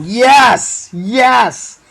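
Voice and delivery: high, loud mock victory scream; screaming yes. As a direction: belt out a gloating chant of triumph. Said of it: "he starts screaming".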